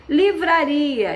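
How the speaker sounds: Livraria read out slowly and drawn; slow drawn word. Shown as a demonstration. Teacher dictating the exercise word, slow and clear. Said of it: "primitivo correspondente".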